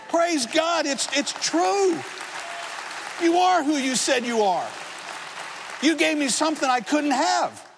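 high, ringing cries of joyful praise; ecstatic testimony. Real, not acted. Pastor reliving his conversion to the congregation, ecstatic. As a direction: proclaim it like a revelation bursting out.

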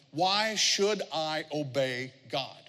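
drawn out word by word; slow, deliberate question. Shown as a demonstration. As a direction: weigh every word of the question. First, deliberate take of question.

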